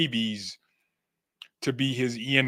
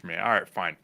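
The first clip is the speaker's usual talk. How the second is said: ordinary delivery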